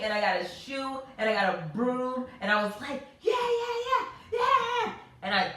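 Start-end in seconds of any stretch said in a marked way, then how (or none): 3.2-4.9 much higher, louder victory shouts; triumphant yell. Retold or imitated. Mother crushing the bug with a shoe, yelling. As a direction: whoop as you smash it.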